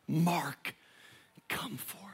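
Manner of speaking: soft whisper, fading on come forth; whispered call. Acted. Jesus calling the preacher by name, whispered. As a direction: breathe the call.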